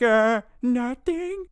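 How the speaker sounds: put-on high, slow guilty kid; sheepish kid voice. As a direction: say it like a caught boy. Kid caught by mom.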